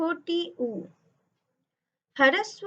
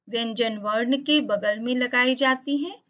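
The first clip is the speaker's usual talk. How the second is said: ordinary delivery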